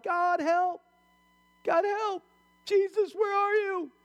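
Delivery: very high mock cry for help. Man hanging from a cliff branch calls to God, crying out. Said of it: "he's crying out to God".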